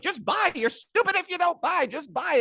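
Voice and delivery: high, far-off mocking hype voice; shrill crypto shill yelling buy; Max Keiser impression. Mocking Max Keiser to a podcast host, high pushy hype. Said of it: "Max Keiser being like".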